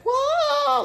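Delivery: very high, startled mock cry; acted freak-out. Patient refusing to let staff push her arm, high alarmed yelp. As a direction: yelp it like fending someone off. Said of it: "I'm going nuts, right?".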